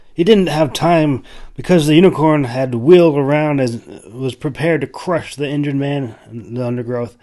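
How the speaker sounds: plain reading voice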